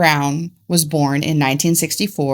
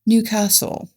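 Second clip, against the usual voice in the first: Newcastle in a higher, mock uppity English accent; 5 semitones higher than usual. Shown as a demonstration. Showing co-host the other English accent, uppity and higher. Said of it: "or you have the uppity".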